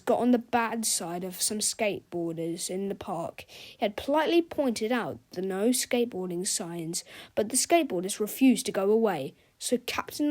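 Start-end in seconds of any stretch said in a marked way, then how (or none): none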